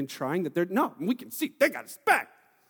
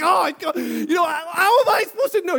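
exasperated shout; 8 semitones higher, 7 dB louder than usual. Retold or imitated. Husband exasperated at wife's look.

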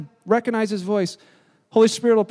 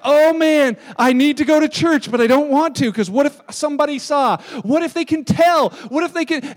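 guilty churchgoer; 3 semitones higher, 6 dB louder than usual. Retold or imitated. Pastor mimics guilty churchgoer.

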